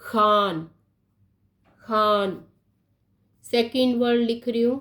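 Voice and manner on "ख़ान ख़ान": खान with a raspy throat kh, ख़ान; nukta pronunciation. Shown as a demonstration. Teacher reading the word with the nukta, raspy खान. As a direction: scrape the start of खान in the throat. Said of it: "कैसे पढ़ रही हूँ उसको".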